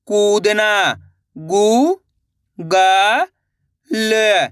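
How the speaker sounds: slow classroom phonics drill; syllable-by-syllable reading. Shown as a demonstration. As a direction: spell each syllable apart with pauses, then say the whole word. Teacher reads syllables then whole word.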